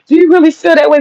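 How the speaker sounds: a hint of a startled friend; incredulous friend quote. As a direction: lightly imitate her shocked asking. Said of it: "She was having a hard time".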